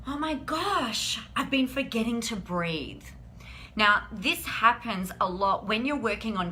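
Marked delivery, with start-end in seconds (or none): 0.1-3.0 retold realisation. Reading an Instagram meme, suddenly startled. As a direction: draw it out, startled and high. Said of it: "And I was just like".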